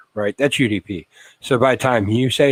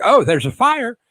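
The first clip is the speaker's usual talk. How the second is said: much higher, louder mock surprise; hammed-up sudden realisation; surprised wife; 13 semitones higher, 4 dB louder than usual. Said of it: "She's like".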